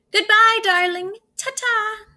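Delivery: high, posh-sounding farewell from a grand lady; fancy aunt. Acted. Aunt Silver saying goodbye to Spoon, fancy high voice. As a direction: trill it like a proper society aunt.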